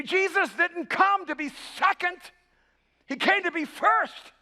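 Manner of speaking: high, loud, impassioned sermon delivery; ringing pulpit declaration; full-force preaching. Acted. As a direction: preach it to the whole room, pitched right up.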